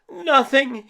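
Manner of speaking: high, sharp startled yelp; a scared kid yelping at his mum. Acted. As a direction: blurt it out, caught and jumpy. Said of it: "he yelped".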